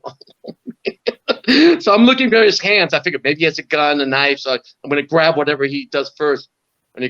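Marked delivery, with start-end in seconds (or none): 0.0-1.8 panicked thought. Retold or imitated. Inner panic facing attacker.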